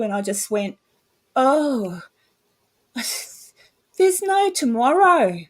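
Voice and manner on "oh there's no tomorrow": slow, high sighing wail of hopelessness; retold despair. Mother recalling the night her son died, retold despair. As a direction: relive a sudden wave of despair. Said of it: "a wash came over me of absolute despair".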